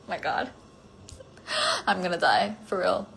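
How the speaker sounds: ordinary talk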